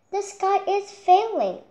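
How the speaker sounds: high, loud frantic cry; panicked cry. Acted. Chick crying the news to Henny Penny, panicked. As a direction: cry it out in alarm. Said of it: "cried Chicken Licken".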